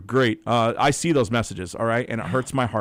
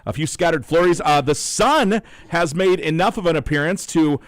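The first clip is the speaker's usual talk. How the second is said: louder, upbeat announcer read; radio announcer read; 4 semitones higher, 8 dB louder than usual. Acted. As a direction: read the weather to listeners on air. On-air weather and phone read.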